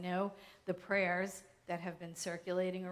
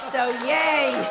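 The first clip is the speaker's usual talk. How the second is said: loud, higher-pitched real cheer; celebratory cheer; 5 semitones higher, 15 dB louder than usual. Real, not acted. Speaker celebrating James's good news with the room, cheering.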